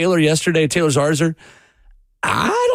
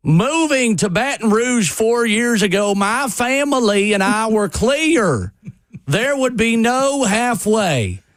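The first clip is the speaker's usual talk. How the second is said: slow, higher, hammed-up Southern drawl reading a statement; fake Southern accent; 7 semitones higher, slower than usual. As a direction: read it like a mock Southern coach, drawn out. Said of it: "I'm gonna stop doing the fake Southern accent".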